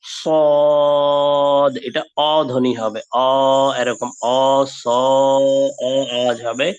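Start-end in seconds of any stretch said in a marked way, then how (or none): 0.0-1.8 সদ thick and heavy with full mouth; heavy sad. Shown as a demonstration. Teacher showing the heavy letter. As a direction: puff out সদ from the whole mouth. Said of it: "পুরু করে পড়তে হবে".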